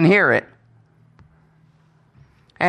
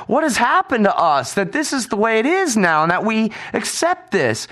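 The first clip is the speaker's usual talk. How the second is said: complaining out loud; 5 semitones higher than usual. Retold or imitated. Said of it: "complaining out loud in the line".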